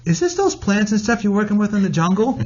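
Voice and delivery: put-on mom voice; mother's voice. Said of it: "And she goes".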